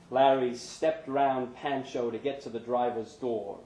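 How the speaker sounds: plain reading voice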